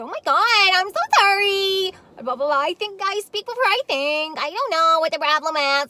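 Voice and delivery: high, loud, hammed-up sorry; mock gushing apology. Mocking Tanya's gushing apology to the caller, high and loud. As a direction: squeal an exaggerated apology.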